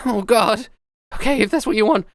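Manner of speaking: hesitant and worried, unsure of leaving; worried Crumb. Acted. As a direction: Crumb hesitating before leaving Navina, anxious. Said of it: "And he goes".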